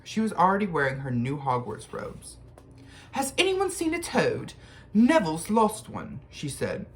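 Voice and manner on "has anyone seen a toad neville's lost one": put-on much higher bossy girl voice. A narrator's reading. Bossy girl asking the boys about a lost toad. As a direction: bustle in like you own the place. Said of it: "She had a bossy sort of voice".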